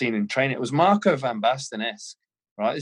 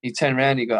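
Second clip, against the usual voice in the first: ordinary talk, nothing marked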